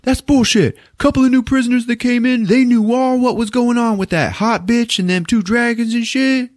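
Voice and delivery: high, loud yelled complaint; mock yelling inmate. Inmate yelling at the warden about the show, high loud yell. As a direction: holler it out like a rowdy prisoner. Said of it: "one guy starts yelling out".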